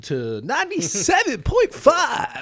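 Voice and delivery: high, slow announcer croon; radio DJ voice. Pretend. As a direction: oversell it like a radio host. Mocking radio DJs.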